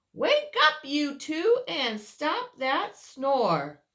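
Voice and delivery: loud high wake-up call; sing-song call to wake him up. Acted. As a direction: rouse the sleeper with a cheery shout. Character calls sleeper awake.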